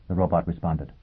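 usual speaking voice

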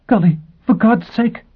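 high, loud startled woman's voice; shocked woman caught off guard; startled cry. Acted. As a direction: cry out in alarm from behind the door. Gisbella startled behind door.

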